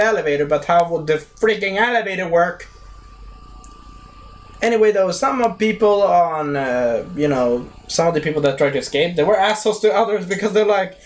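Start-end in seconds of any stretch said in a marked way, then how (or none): none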